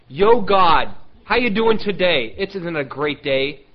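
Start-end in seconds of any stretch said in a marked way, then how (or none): 0.1-2.3 loud, breezy shout-out greeting; casual hailing. Acted. Preacher acting out walking right up to God, loud hailing. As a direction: call out like hailing a buddy.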